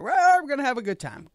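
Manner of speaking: high, loud, quick mock revelry; hammed-up celebrating voice; rowdy party cheer. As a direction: imitate partiers whooping it up. Acting out New Year revellers.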